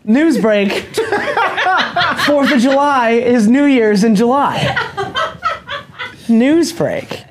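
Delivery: high, laughing fake headline read; mock news anchor. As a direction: announce it like a newsflash, cracking up. Mock news announcement joke.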